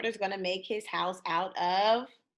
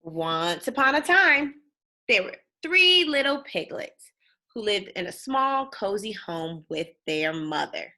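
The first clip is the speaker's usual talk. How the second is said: put-on lively read-aloud for kids, bouncy tune; storybook reading; 6 dB louder than usual. A narrator's reading. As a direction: read it like bedtime story time.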